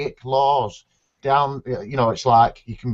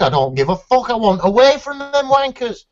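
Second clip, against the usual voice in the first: retold defiant outburst; 8 semitones higher than usual. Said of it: "And I said".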